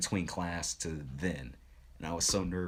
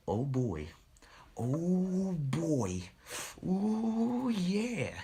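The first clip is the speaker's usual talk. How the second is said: put-on thrill, climbing higher; building excitement; 12 semitones higher, much slower than usual. Student realizing he found his calling, excitement building high. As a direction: draw it out with growing glee.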